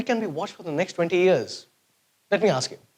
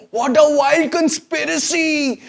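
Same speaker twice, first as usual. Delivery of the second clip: mock outrage; 5 semitones higher, 3 dB louder, slower than usual.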